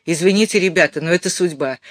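ordinary delivery, nothing marked

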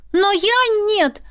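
high, drawn-out protest; indignant. A narrator's reading. Girl standing by Osha rejects the match, indignant. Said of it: "возмутилась девушка".

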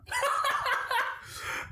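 airy high-pitched laugh; high breathless laugh. Real, not acted. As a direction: burst into a silent wheezing laugh. Laughs at bite joke.

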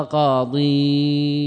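قاضيون held out slowly as "qaa-diii-uun"; slow, level, drawn-out قاضيون; two long vowels clashing. Shown as a demonstration. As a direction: stretch the ī into the ū in قاضيون. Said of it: "اجتمع عندنا الساكنان".

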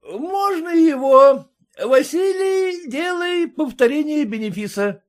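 put-on loud, high drunken command; shouting. A narrator's reading. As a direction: bellow the order. Merchant orders repeat.

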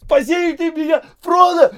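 very high, frantic scream for help; wild, loud plea; panicked cry. A narrator's reading. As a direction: shriek as if drowning. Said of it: "заполошно выкрикнул Сэм, кашляя и отплёвываясь".

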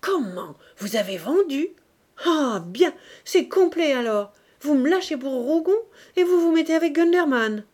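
colère qui monte jusqu'au cri, beaucoup plus fort et plus aigu; colère qui éclate. A narrator's reading. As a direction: explose de rage contre le traître. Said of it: "la colère qu'il dissimulait ... éclata". (éclat de colère furieux, crié)

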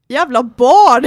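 very loud re-enacted outburst; angry insult. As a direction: hurl the retort at full volume. Acted insult back at the girl. (högljudd, återgiven förolämpning)

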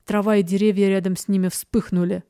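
usual speaking voice

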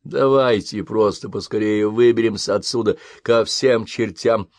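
ordinary delivery, nothing marked

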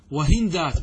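وهندات as "wa-hin-daat", no vowel after n; vowelless middle consonant. Shown as a demonstration. Teacher gives three dialect forms to students, وهندات as "wa-hin-daat". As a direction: close the n straight onto d in وهندات. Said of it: "بالسكون".

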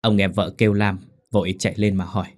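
ordinary delivery

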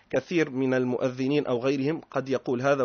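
ordinary talk

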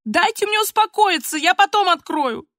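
put-on girl's high-pitched entreaty; pleading. A narrator's reading. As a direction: beg through the locked door. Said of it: "просила Полина".

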